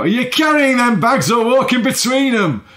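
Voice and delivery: high, loud broad Yorkshire shout. Retold or imitated. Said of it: "he'd shout".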